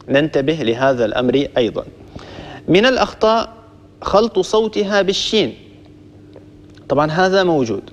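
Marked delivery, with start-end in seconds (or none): none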